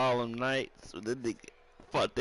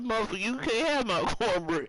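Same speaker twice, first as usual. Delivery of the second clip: higher, mock-serious declaration; mock dead-serious quote; 8 semitones higher, 4 dB louder than usual. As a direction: play it dead serious but pitched way up.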